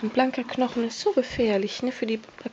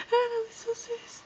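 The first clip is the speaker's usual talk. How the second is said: cooing; 13 semitones higher, 7 dB quieter than usual. Real, not acted. Cooing at the pet.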